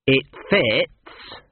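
slow, hesitant prince; a doubtful character voice. A narrator's reading. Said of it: "but in a doubtful tone of voice".

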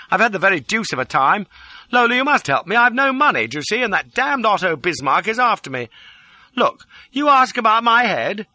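high, urgent outpouring, often loud; distraught pleading. A narrator's reading. Fugitive begging Lola for help, distraught. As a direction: plead wildly for rescue. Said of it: "The distraught pleading line".

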